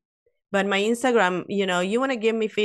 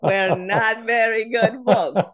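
laughing; 4 semitones higher, 5 dB louder, slower than usual. Real, not acted. Embarrassing story, laughs heard.

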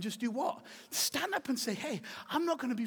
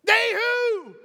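mock indignant challenge; 11 semitones higher, 11 dB louder than usual.

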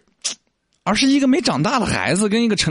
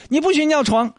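put-on telling a toddler; 10 semitones higher than usual. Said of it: "就像你跟一个三岁的孩子说".

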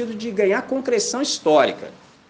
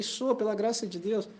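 soft reply; 4 semitones higher, 9 dB quieter than usual. Retold or imitated. Pastor answers softly.

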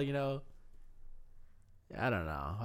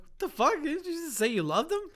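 incredulous dad; 12 semitones higher, 4 dB louder than usual. Retold or imitated. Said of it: "I'm like".

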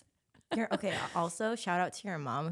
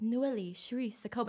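put-on "Noelle Cherie Sakoba" in a lilting French Canadian accent, pitched up; 5 semitones higher than usual. French Canadians admiring her name, imitated French Canadian accent. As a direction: say the name as French Canadians would, higher and flowing. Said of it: "French Canadian people would be like".

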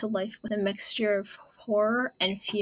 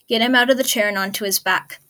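urgent order; 8 dB louder, faster than usual. Acted.

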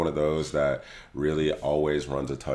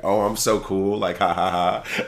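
cocky; 5 semitones higher, 6 dB louder than usual. Retold or imitated. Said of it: "my dumb ass in the moment, you think".

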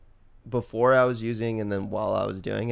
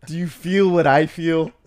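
hammed-up anguish, loud and high; mock pained voice; 8 semitones higher, 9 dB louder than usual. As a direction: jokingly wail it as if full of pain. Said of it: "can you hear the pain in my voice".

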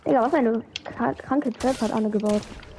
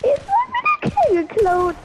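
outraged yelp. Real, not acted. Gamer outraged at stolen kill.

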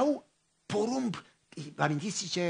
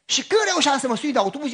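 ordinary talk

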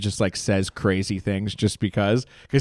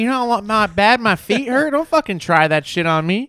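high, mock-cranky complaining voice; grumpy old comic; 9 semitones higher than usual. Big Tim grumbling at a young comic, high and cranky. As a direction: whine about his back and feet. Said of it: "He's like".

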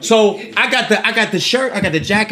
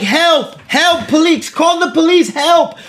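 higher, hammed-up help cry; mock cry for help; 6 semitones higher, slower than usual. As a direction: play the fake victim calling for help, pitched up. Retold man crying for help.

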